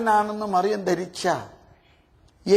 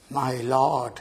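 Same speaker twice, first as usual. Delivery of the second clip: reverent murmur; 8 semitones lower than usual. Acted. Mary's reverent reply.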